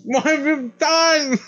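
mock horror. Mock horror scene with Nathan.

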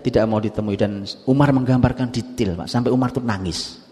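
ordinary talk, nothing marked

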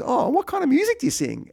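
wife chatting to a stranger she doesn't recognise, mock innocent; imitating wife's innocent question. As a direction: play the clueless wife asking sweetly.

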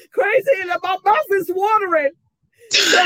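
excited. Real, not acted. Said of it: "cake praise".

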